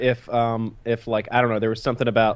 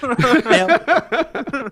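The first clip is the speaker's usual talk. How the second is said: nailed it, cracking up; laughing drawn-out praise; 14 semitones higher, 3 dB louder, much slower than usual. Real, not acted. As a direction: stretch it slow, much higher, laughing.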